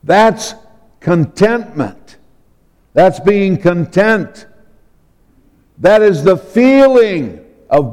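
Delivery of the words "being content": "content" stressed at the end, con-TENT; light first syllable in "content", weight on TENT; stress on second syllable. Shown as a demonstration. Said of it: "put the emphasis on the other syllable".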